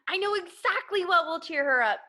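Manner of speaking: high, bright eureka as a sudden idea hits; a boy struck by a brilliant idea, excited. Acted. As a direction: blurt it out, thrilled with the idea. Eddie gets an idea.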